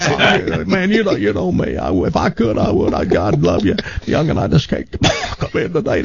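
folksy Southern voice, faking illness; laughing through a sick country impression; sick Davy Lee. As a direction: prank caller as country DJ. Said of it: "a great Davy Lee".

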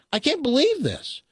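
high, incredulous mock amazement; acted disbelief. Buyer asking the owner why sell, incredulous. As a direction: stretch out the question in high-pitched disbelief.

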